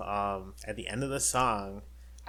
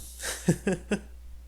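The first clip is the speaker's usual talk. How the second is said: breathy laugh; 9 semitones higher than usual. Real, not acted. Laughing at his own remark.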